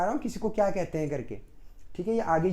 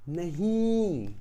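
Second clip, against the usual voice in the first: drawn-out no; much slower than usual. Real, not acted. Teacher answers own question.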